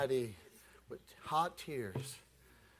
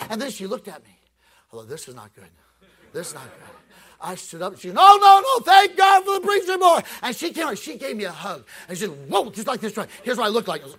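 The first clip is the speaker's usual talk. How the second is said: loud, higher, hammering preacher delivery; preaching at full force; 12 semitones higher, 18 dB louder than usual. Acted. Evangelist telling the congregation his conversion story, shouting. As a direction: hold the revival pitch all the way.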